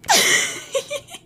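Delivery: grumbling camel groan; camel noise. Pretend. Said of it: "That's my best camel impression".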